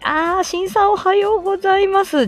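ordinary talk

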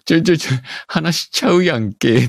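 high, rapid-fire comic objection in Kansai dialect; flustered protest. Retold or imitated. Streamer recalling shock at a colleague's early recording, flustered protest. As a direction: jump in high-pitched, as if caught out.

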